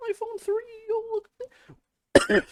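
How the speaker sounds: very high, hammy gasping fan; mock awe. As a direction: squeal over each new iPhone. Mock excited iPhone fans.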